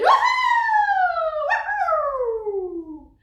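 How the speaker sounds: very high, loud drawn-out whoop; high cheering howl. Acted. Dogs cheering Oscar the hero, high howling whoop. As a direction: howl a falling woohoo.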